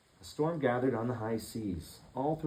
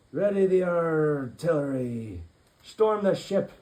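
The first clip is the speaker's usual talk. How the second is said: slow, loud battle order; rally the crew to attack; pirate captain roaring; 8 semitones higher, 9 dB louder, slower than usual. Acted. Pirate captain ordering his crew to attack, loud and slow. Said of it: "roared the pirate captain".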